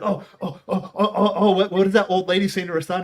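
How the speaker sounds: high, loud string of urgent ohs; imitating excited mom. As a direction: imitate a mother itching to know. Imitates mom eager to know.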